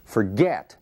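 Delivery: forget with GET punched; forget, weak start and strong GET; stress on the second syllable. Shown as a demonstration. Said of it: "a sílaba tônica é o get".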